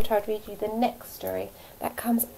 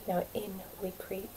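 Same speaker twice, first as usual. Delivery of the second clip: softer, creeping whisper; sneaky hush; 2 semitones lower, 11 dB quieter than usual. A narrator's reading. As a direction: creep in quietly. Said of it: "said Hugh".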